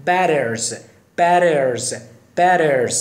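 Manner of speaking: "Batters" with the tt as a quick r-like flap, "bærerz"; American flap t. Shown as a demonstration.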